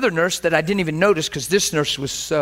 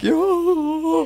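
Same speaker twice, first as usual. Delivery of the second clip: high, long drawn-out howl; mock overcome cry; imitated wail; 11 semitones higher than usual. Acting out being overcome by God, high mock wail. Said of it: "And I'm like".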